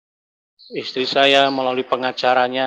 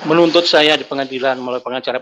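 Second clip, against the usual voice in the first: ordinary talk, nothing marked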